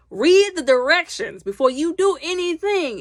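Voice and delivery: stern reminder to viewers; emphatic advice. Real, not acted. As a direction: lay down the rule firmly. Preaching a rule to viewers.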